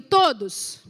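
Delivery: todos said loud and falling; stressed todos. Shown as a demonstration. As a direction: hit todos for the crowd to repeat. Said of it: "repete comigo".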